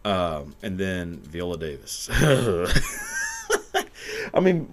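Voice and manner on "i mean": high wheezing laugh spilling into words; real helpless laughter. Real, not acted. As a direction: laugh through the start of the line. Laughing at Viola Davis photo.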